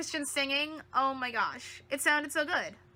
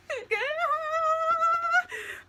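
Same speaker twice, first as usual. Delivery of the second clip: high-pitched giggle; high laugh; 12 semitones higher than usual. Real, not acted. Laughing at fiancé line.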